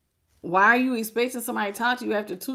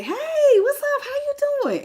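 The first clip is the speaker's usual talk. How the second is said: much higher, bright and louder on "Hey"; excited greeting; 3 dB louder than usual. Shown as a demonstration. Reader contrasting a keen, happy greeting with a cold one, excited. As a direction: gush it like you're thrilled they called. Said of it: "But not like".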